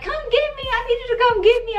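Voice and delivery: high-pitched, rushed plea for rescue; imitated panicked pleading. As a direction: beg your husband in a mock squeal. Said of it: "I'm just going on and on and on".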